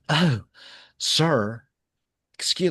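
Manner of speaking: put-on high, affronted plantation-belle accent; hammed-up Southern plantation owner accent, scandalized; put-upon plantation owner accent. Host mocking an official's accent, put-upon plantation owner accent. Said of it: "put upon plantation owner accent".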